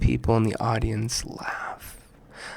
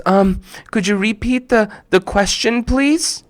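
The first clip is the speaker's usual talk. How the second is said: put-on nervous girl; 9 semitones higher than usual. Amira nervous request.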